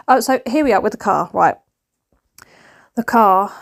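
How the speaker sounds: usual speaking voice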